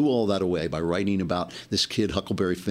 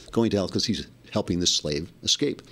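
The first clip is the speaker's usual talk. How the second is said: ordinary talk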